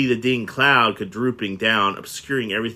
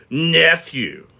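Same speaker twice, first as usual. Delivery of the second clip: louder, curt and stern; stern retort; 6 semitones higher, 5 dB louder than usual. Acted. Scrooge sternly answering his pleading nephew. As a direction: shut him down firmly. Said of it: "returned the uncle sternly".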